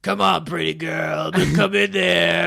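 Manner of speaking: slow, taunting killer coaxing; creepy killer. Retold or imitated. Jason player taunting a hiding victim, slow creepy. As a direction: lure her in slowly, menacing. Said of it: "he's like".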